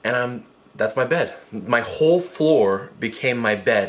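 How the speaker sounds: ordinary delivery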